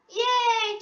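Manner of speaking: high, happy yay; a quick delighted cheer. Acted. Zoe cheering about Peppa's party invitation. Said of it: "cheers Zoe".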